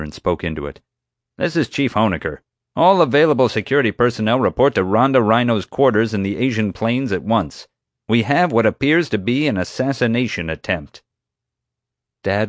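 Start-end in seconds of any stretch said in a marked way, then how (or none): 1.4-11.0 higher, emphatic official radio call; commanding security chief. Acted. Security chief summons all personnel by radio, commanding. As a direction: issue orders with self-important urgency.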